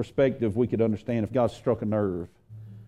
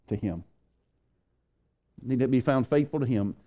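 ordinary delivery

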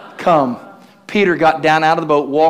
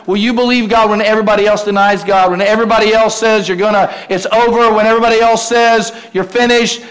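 raised, insistent, rallying sermon delivery; impassioned preaching; 5 semitones higher, 4 dB louder than usual. Real, not acted. Preacher urging the congregation to believe, building with fervour.

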